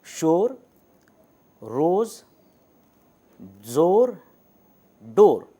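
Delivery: each word said apart with a clear long ओ; long o demo words. Shown as a demonstration. Teacher to students, showing the long vowel with clear separate words. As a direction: shown carefully, word by word: शोर, रोज़, ज़ोर, डोर. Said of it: "long वावेल की, वो अदा हो रही है".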